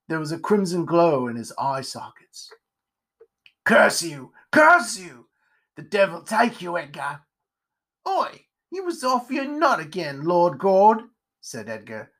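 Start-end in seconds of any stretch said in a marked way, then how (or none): 3.6-7.2 harsh, explosive curse; possessed, melodramatic fury; venomous cursing. Acted. Possessed pumpkinhead curses the spider. 8.0-11.1 high, crotchety reproach; pitched-up aggrieved grumble; indignant spider character. Acted.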